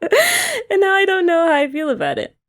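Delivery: high, giggling, words bubbling with laughter; laughing through the words. Real, not acted. Laughs while talking about hair.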